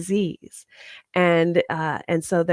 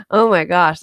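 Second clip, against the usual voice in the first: excited gasp; 3 semitones higher, 4 dB louder than usual. Real, not acted. Excited about the device.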